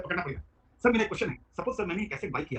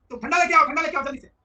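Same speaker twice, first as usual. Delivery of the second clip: higher, louder call to the helper; calling to staff; 9 semitones higher, 8 dB louder than usual. Retold or imitated. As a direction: shout an order across the shop. Shopkeeper calling staff loudly.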